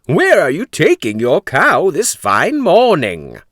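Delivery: put-on slow, drawn-out old stranger; sly old man. Old man asking Jack where he takes the cow, slow and sly. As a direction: lure him in slowly like a wily old man.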